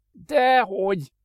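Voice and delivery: high, loud, slow scoff; scornful snort. Acted. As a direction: snort it dismissively. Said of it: "horkantott fel Adrian".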